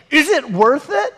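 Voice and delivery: high, incredulous question; mock questioning. Watcher of risky stunt videos, high mock questioning. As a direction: pitch the question up high in mild mock disbelief.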